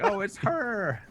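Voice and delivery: high voiced oh it's her; mock realization. Player recognises the wizard's voice, mock high. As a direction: squeak it out. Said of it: "be like".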